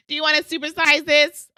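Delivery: upselling cashier. Retold or imitated. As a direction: push the supersize hard, like a cashier. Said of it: "They're like".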